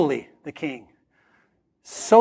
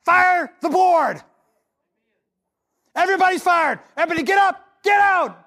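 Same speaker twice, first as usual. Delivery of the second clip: Peter shouting; 11 semitones higher than usual. Retold or imitated. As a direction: bark the board out of the room. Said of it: "Peter came in and said".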